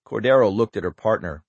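plain reading voice

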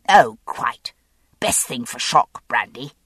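usual speaking voice

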